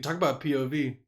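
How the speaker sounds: ordinary delivery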